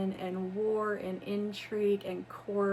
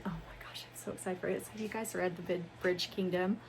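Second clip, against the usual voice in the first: normal talking voice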